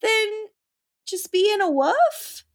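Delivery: high-pitched mock disbelief; incredulous retort. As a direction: question it in exasperated high pitch. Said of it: "And I'm like".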